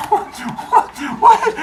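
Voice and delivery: high, loud, baffled repeats; mock bewildered squawk; startled what. Startled fighter in retold story.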